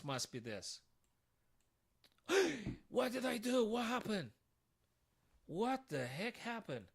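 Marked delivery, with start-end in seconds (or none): 2.3-2.8 sharp high gasp of shock. Real, not acted. Shock at a blunder. 2.9-6.8 can't believe what just happened; shocked disbelief. Real, not acted. Realises he blundered.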